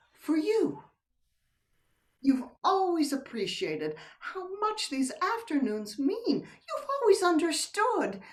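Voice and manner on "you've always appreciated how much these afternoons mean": ordinary talk, nothing marked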